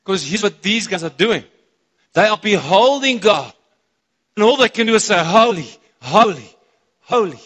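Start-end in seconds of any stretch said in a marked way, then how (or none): 2.1-7.4 loud, fervent proclamation; ringing, raised cry of worship; excited preaching. Real, not acted. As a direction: preach it out, carried away with excitement. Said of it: "I get so excited".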